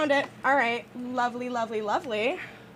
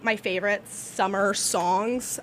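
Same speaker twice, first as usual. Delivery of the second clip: ordinary talk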